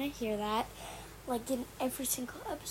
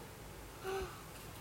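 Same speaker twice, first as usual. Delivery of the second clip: sharp unvoiced intake of breath; shocked gasp; 5 semitones higher, 11 dB quieter than usual. Real, not acted. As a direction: gasp in sudden alarm. Realizes school starts tomorrow.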